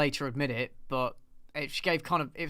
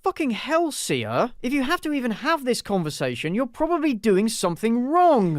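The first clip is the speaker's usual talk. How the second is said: exasperated outburst; 5 semitones higher, 4 dB louder than usual. Real, not acted. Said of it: "exasperated rant at [name]".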